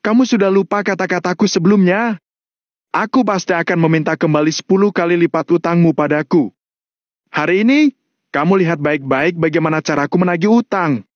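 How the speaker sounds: high, taunting threat; menacing Valentino. Acted. Valentino warns John how he collects debts, menacing.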